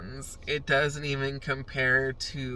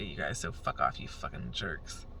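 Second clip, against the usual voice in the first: mock insult; 10 semitones lower, 8 dB quieter than usual. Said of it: "Just kidding".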